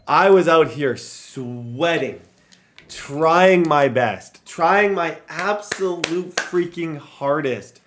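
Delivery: slow, loud, each word leaned on; emphatic and drawn out. Real, not acted. Streamer telling Giants fans he tried, slow and emphatic. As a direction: drag it out to show how hard he tried.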